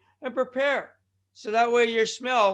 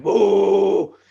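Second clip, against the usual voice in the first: long, loud "whoa" cry; drawn-out earthquake yelp, played up; mock alarmed whoa; 11 dB louder than usual. Acting out someone feeling an earthquake, loud drawn-out whoa.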